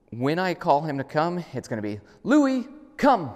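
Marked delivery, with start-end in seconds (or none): 2.2-3.3 much higher, louder, crisp name then command; clear recall command. Shown as a demonstration. Trainer demonstrates the recall call across the yard, clear and loud. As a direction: call him in sharp and clear. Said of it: "I'm gonna be really clear".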